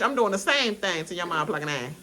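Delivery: ordinary delivery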